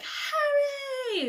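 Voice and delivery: very high, loud cry of his name; joyful shout. Retold or imitated. Friends greeting Harry with a very high joyful shout. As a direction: cheer him. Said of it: "we were all like".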